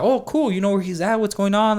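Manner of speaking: high, eager rush of relief; eager questioning. Retold or imitated. Boss to sheriff about missing trucker, eager questioning. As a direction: ask hopefully, pitched well up.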